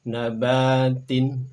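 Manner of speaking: "Nabatin" very slow and loud, ending in -tin; model Arabic reading. Shown as a demonstration. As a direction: stretch out "Nabatin" for students to copy. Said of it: "Berikutnya silakan". ("Nabatin" dibaca sangat pelan dan lantang)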